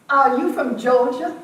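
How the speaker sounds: a Georgia lady's eager question; surprised Georgia woman. Retold or imitated. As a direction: play the woman from back home who can't believe it. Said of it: "she said to me".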